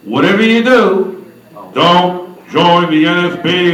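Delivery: loud, emphatic mock warning, slowing down; retold warning. Mary advising [name] against joining, emphatic mock warning. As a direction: warn them off with heavy deliberate weight. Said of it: "she gave me the advice that we all hear".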